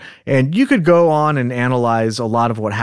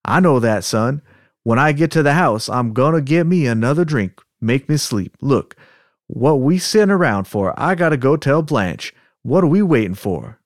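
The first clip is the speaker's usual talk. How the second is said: put-on Southern accent. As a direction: read it as a worked-up Southern man. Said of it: "practice with our Southern accents".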